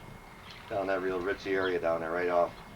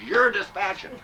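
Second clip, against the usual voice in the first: loud and higher, "You're dispatching" barked out; angry order; 11 semitones higher, 7 dB louder, slower than usual. Retold or imitated. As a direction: snap "You're dispatching" at him loudly and high. Angry chief quoted in story.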